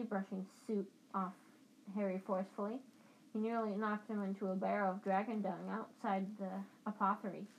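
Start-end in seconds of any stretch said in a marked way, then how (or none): none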